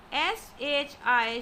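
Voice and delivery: ordinary talk, nothing marked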